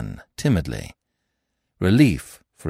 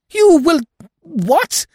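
much higher, louder shocked cry; astonished; 7 dB louder, slower than usual. A narrator's reading. Hearing her friend's stepfather admit theft, high shocked outcry. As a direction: blurt it out in disbelief. Eve hears of stealing.

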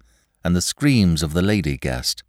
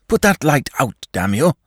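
sharp, higher angry order; 8 semitones higher, 4 dB louder than usual. A narrator's reading. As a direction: snap it at him, pitch up. Shell-shocked master wakes servant.

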